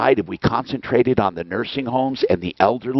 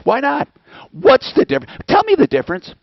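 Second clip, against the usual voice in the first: angry challenge; 12 semitones higher, faster than usual. Real, not acted. Angry rant demanding an answer.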